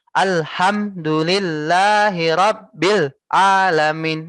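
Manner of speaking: Alhamdulillahi Rabbil Alamin chopped word by word; choppy separated reading. Shown as a demonstration. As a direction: read it broken up on purpose. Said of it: "Itu dipisah-pisah bacanya ya". (dibaca putus-putus)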